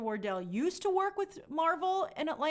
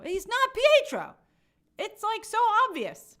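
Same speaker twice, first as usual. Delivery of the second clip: emphatic; 10 semitones higher, 8 dB louder than usual. Real, not acted. Rebutting fans emphatically.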